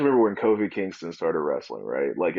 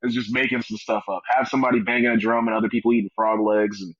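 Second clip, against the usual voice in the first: ordinary talk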